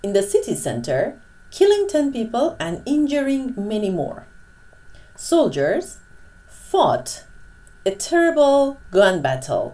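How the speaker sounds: reads each phrase slowly and clearly for learners; slow model reading. Shown as a demonstration. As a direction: spell out the sentences like a teacher. Reads the model sentences aloud. (خواندن آهسته و شمرده برای شاگردان)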